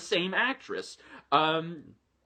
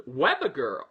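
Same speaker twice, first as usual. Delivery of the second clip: usual speaking voice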